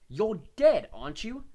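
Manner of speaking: loud, high and fed up; exasperated. A narrator's reading. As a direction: throw it at him, exasperated. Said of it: "said Harry exasperatedly".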